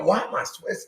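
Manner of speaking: usual speaking voice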